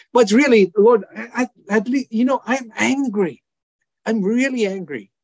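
high, loud anguished confession of anger; angry plea to God. Retold or imitated. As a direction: burst out with it, Lord I'm angry.

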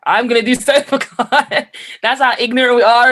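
high, loud laughing through the words; giggling loudly at how silly it sounds. Real, not acted. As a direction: laugh at your own naivety as you say it. Laughing at own naive vow.

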